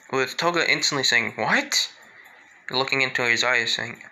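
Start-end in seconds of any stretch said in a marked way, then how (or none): 1.4-1.9 sharp surprised rise on a high pitch; startled what. Retold or imitated. Toga hearing Leon bumped into the girl, startled. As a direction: jolt up in shock at the news.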